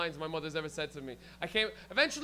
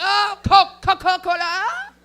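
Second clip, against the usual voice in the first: high, loud, drawn-out outburst; slow, high holler, hammed up; mock excited shout; 11 semitones higher, 15 dB louder, slower than usual. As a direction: blurt the answer as if finally remembering. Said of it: "you're like".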